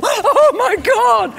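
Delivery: high, slow, breathless oh my God; awestruck gasp. Retold or imitated. Tourists meeting soap stars, awestruck and thrilled. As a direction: gasp it as if seeing the Virgin Mary. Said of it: "and they'd go".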